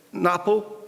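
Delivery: ordinary delivery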